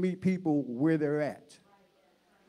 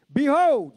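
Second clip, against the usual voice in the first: "Behold" pitched high as a proclamation; emphasis on Behold; 7 semitones higher, 5 dB louder than usual. Shown as a demonstration. Preacher reads the great voice from heaven, stressing "Behold". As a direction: lean hard on the word "Behold". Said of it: "you gotta put some emphasis on behold".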